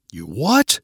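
mildly acted disbelief, rising sharply on "what"; shocked cry. A narrator's reading. Boyfriend learns the backpack is gone, shocked cry. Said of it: "cried Kent".

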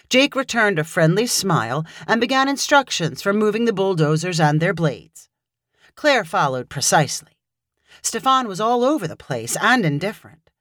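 usual speaking voice

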